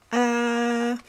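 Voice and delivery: long, high "aah" as in apple; demonstrated short A. Acted. Pig copies Goat's short A, held high. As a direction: hold a high steady short A. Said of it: "make a short A sound".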